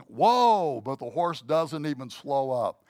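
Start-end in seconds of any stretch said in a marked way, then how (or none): 0.1-0.8 loud, high panicked whoa; yelling. Retold or imitated. Panicked rider yells at the runaway horse. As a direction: yell it as the cliff edge nears. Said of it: "he starts yelling".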